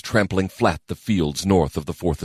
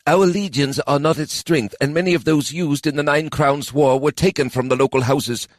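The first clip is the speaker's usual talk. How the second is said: a hint of a higher, heavy elf; lightly voiced elf officer; 8 semitones higher than usual. A narrator's reading. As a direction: report the bad news plainly. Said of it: "answered Kadan".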